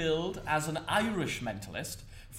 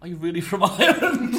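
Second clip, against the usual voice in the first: louder, higher mock question through laughter; 4 semitones higher, 8 dB louder than usual. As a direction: imitate a doubting audience member, breaking into laughter. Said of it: "What did they say?".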